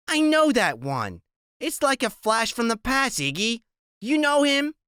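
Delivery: a wary tough woman; raspy woman voice. Acted. Narrator voices Loretta.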